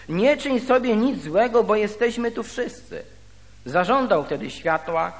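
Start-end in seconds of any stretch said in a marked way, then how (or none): 0.1-3.0 mildly projected call, pitched up; raised, carrying voice. A narrator's reading. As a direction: read it out higher and somewhat louder. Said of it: "odezwał się donosnym, donośnym głosem, mówiąc". (lekko podniesiony, donośny głos)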